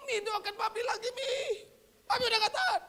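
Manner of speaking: high, pleading voice of a sick father, rising to a shout; sick father calling to his wife for prayer, high and pleading. Retold or imitated. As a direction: beg your wife to pray for you, voice up high. Father begging again for prayer.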